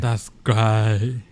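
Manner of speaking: mock British reply; terrible British accent; bad London accent. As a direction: put on a fake London accent. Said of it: "those are terrible accents".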